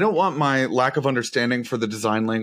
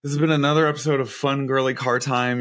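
usual speaking voice

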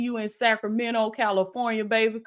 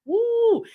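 a high, sudden whoop of joy; high excited woo; 13 semitones higher than usual. Real, not acted. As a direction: whoop it out like church just caught fire. Preacher hypes the prophecy.

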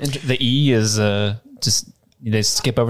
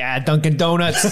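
mock Boston accent; 6 semitones higher than usual. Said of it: "there's usually".

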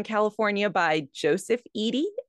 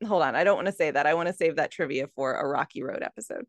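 fast, lower self-interruption; rushed aside; 4 semitones lower, much faster than usual. Real, not acted. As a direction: hurry through the aside. Stops herself from spoiling trivia.

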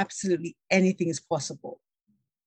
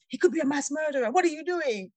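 fast, higher, alarmed; panicky inner voice; 9 semitones higher, faster than usual. Retold or imitated. Her fearful inner voice warning about a stranger, fast and high. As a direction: let the frightened part of the brain rush out. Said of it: "one part of my brain is".

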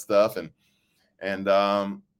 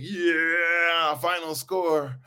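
big announcer yeah; 8 semitones higher, much slower than usual. Shown as a demonstration. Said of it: "I normally hit my big".

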